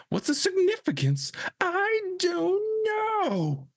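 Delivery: very high-pitched wail, last words dragged out; high, drawn-out meltdown cry; distraught outburst. Acted. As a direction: wail it high and stretch the ending. Quoting the distraught bike owner.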